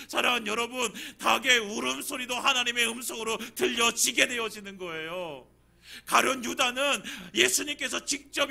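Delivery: loud, rising sermon delivery; fervent preaching. Acted. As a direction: drive the point home. Preaching at full force. (열정적으로 설교하듯)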